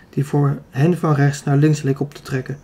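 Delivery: ordinary delivery, nothing marked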